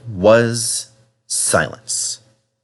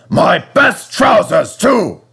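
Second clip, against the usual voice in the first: higher, louder, slow indignant yell; outraged shout; 9 semitones higher, 5 dB louder, slower than usual. Acted. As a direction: wail about the ruined trousers. Controller outraged at trousers.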